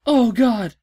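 usual speaking voice

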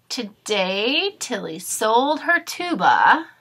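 lively storybook read-aloud; reading to children. Acted. Teacher reading a storybook aloud to her class, lively. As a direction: read it brightly to young listeners. Reads picture book to class.

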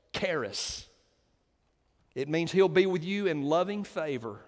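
ordinary delivery

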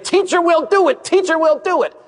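put-on high, eager child voice calling out; eager kids. Kids volunteering to the teacher, high eager call. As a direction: pipe up like keen kids volunteering.

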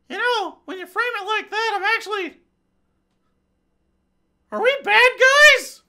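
high falsetto comic husband, rising to a loud cry; squeaky husband voice. Pretend. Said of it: "her conservative husband".

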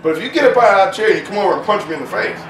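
loud, fast and fired up; heated challenge. Real, not acted. Daring an attacker to come at him, loud and fast. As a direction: rush it like a threat.

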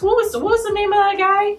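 high, quick, squeaky searching; trying to recall. Real, not acted. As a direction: fumble for a forgotten name. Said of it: "oh, jeez".

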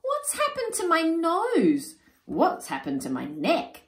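high, loud fretting; panicked wail. Acted. Warthogs panicking over their new bodies, high wailing. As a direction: lament each new body part.

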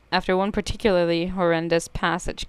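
ordinary talk

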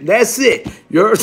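ordinary delivery, nothing marked

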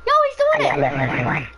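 high, very slow put-on voice; put-on cartoon voice. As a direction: stretch it out like a cartoon. Friend doing the voice.

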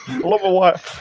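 laughing. Pretend. Mocking the line, laughing.

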